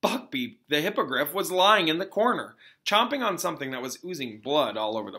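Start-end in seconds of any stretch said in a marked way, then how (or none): none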